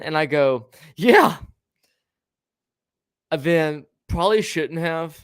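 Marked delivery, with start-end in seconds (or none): 1.0-1.4 high, loud stunned yeah; shocked yeah. Retold or imitated. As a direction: blurt it out in shock. Shocked agreement at dumpster.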